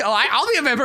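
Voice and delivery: mock eager player. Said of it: "you say that, like".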